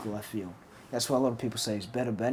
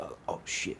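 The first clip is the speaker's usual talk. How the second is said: hushed fright; 8 semitones lower, 10 dB quieter than usual. Retold or imitated. Retold fright as dog charges.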